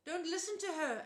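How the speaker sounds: high, loud urgent shout. A narrator's reading. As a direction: warn him from hiding. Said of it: "Annabeth's voice shouted".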